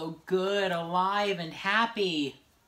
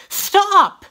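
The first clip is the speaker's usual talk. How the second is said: sharp shout; 13 semitones higher, 12 dB louder than usual. Acted. Sudden shout to stop.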